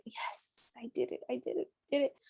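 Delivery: breathless whisper of relief; whispered relief as the contraction fades; whispered triumph. Retold or imitated. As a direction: whisper it, proud and spent. Relief after a contraction peak.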